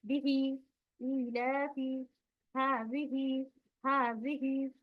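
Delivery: slow recitation, final "ee" of बिही held; stretched long vowels. Shown as a demonstration. Teacher demonstrating khadi zer examples, stretched long vowels. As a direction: draw out the long vowels in इलाफी and हाजिही. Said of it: "खड़ी जेर".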